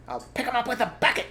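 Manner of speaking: high, fast shouting farmer; quick loud high threat; shouted. Acted. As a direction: bark the threat. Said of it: "shouted Bunce".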